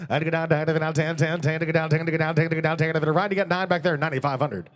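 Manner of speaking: usual speaking voice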